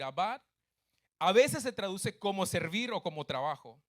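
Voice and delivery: usual speaking voice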